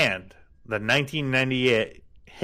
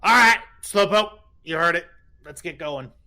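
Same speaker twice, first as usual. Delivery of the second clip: calling out to the crew, loud; gruff captain; 5 semitones higher, 5 dB louder than usual. Acted. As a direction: bark orders to the dock hands. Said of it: "he goes".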